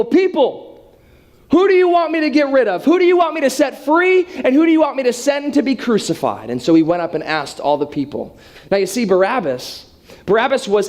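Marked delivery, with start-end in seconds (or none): 0.0-6.5 higher, projected public call; judge addressing the crowd. Acted. Judge puts the choice to the people, high projected proclamation. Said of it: "he begins to, to say to the people".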